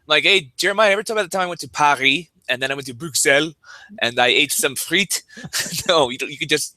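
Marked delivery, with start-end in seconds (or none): 0.2-5.2 show-off traveler with French place names; pretentious French pronunciation. Retold or imitated. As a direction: drop overdone French words into English. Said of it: "you can use your normal accent".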